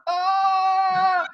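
high strained cry of dismay; anguished cry. Retold or imitated. Recalling his regret in the Andes, a high anguished cry. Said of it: "I'm in the Andes going".

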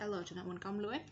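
usual speaking voice

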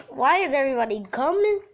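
usual speaking voice

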